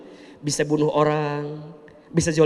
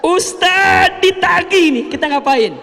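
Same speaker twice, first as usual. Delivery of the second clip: mock worried questioner; 12 semitones higher, 6 dB louder than usual. Congregant asking about debts.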